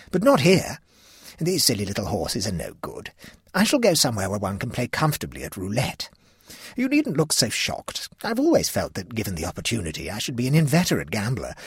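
a hint of an old aunt, gleefully reckless; lightly voiced gambling aunt. Aunt toasting her shocked nieces at dinner, lightly voiced and merry.

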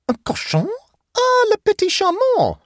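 put-on high-pitched girlish delight in French; enthusiastic exclamation. A narrator's reading. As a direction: gush with delight, soaring high and loud. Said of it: "exclaimed Matilda with enthusiasm".

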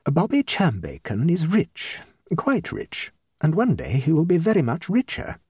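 measured, stately hostess voice; slow and impressive. A narrator's reading. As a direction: state your case like a minister addressing parliament. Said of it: "she spoke slowly and impressively, with the air of a minister".

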